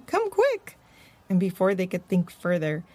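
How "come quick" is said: high urgent cry; high squeaky call to run. Acted. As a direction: hurry the girls along, high. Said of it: "cried Morty".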